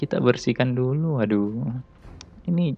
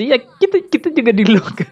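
laughing; 12 semitones higher, 4 dB louder than usual. Real, not acted. Locked down again.

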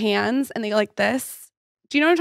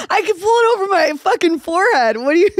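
high-pitched laughing talk; laughing through the words; 9 semitones higher, 5 dB louder than usual. Real, not acted. Laughing at giant lips.

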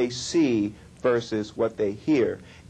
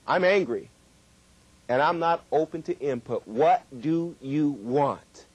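higher, abrupt and sharp, the last words slowed; abrupt loud voice; 3 semitones higher than usual. Shown as a demonstration. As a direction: sound angry and closed to input. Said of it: "an abrupt and very loud voice".

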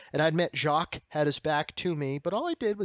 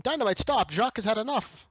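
pleading Raymond; 8 semitones higher than usual. Retold or imitated.

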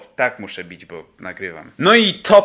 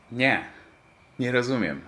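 student's reply; 3 semitones lower, 9 dB quieter than usual. Acted. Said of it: "student answers teacher".

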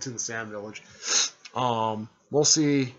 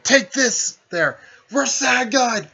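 much higher and louder, drawn-out jutsu cry; battle shout; 11 semitones higher, 9 dB louder, slower than usual. Retold or imitated. Haruko hits them from behind, roaring Rasengan. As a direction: roar the technique name as you strike.

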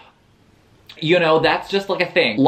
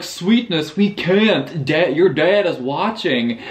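Ryan read in a vague put-on accent, slightly higher; mock the book's boyfriend in an odd accent; 4 semitones higher than usual. Narrator mocking Ryan's line to Haley in an odd put-on accent. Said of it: "what was that accent?".

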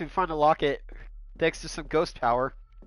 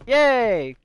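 higher, louder little cheer; happy cheer; 7 semitones higher, 8 dB louder than usual. Real, not acted. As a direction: celebrate the find. Finds more photo pieces.

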